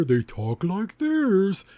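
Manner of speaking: high, very slow drawn-out big voice; giant-sized character voice. Shown as a demonstration. Reviewer showing how grown-big characters talk, high and slow. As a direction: stretch every word like a grown giant. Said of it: "whenever a character gets bigger".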